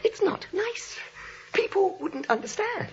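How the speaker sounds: flustered scolding. Acted. Flustered scolding of sweet aunts.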